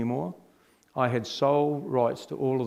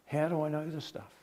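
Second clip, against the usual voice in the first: hushed, wondering self-question; inner thought, hushed; 3 semitones higher, 9 dB quieter than usual. Retold or imitated. Quoting scripture, privately asking himself, hushed. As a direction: mutter it to yourself in disbelief.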